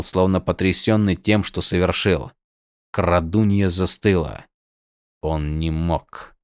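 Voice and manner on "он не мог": stunned hush. A narrator's reading. Shocked stunned thoughts, slow quiet.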